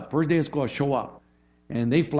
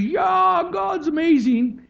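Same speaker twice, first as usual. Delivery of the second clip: high, loud cheer; hammed-up crowd rejoicing, drawn out; mock celebration; 5 dB louder, much slower than usual. The people celebrate what God did, mock high cheer.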